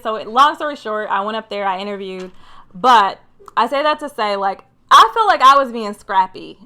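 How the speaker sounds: ordinary talk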